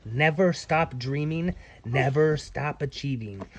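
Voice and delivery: usual speaking voice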